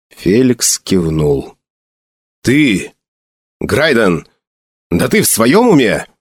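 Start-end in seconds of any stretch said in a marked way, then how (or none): 2.4-6.1 halting, indignant disbelief; mild outburst, short of a shout; almost shouted. A narrator's reading. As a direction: build to an incredulous question with jumping pitch. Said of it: "Гил почти выкрикнул эту фразу".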